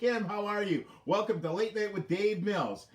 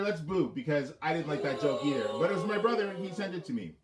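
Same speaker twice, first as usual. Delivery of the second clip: ordinary delivery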